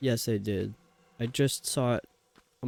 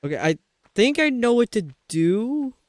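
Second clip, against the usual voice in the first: excited realization; 8 semitones higher, 4 dB louder than usual. Real, not acted. Sudden realization.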